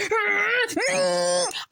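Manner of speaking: high strangled cry of anger; furious frustrated scream. Retold or imitated. Kid hearing others saw the eclipse, frustrated scream. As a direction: let out a choked yell of rage. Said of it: "And I'm fuming".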